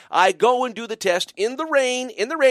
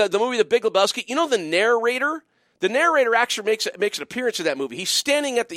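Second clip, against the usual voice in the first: ordinary delivery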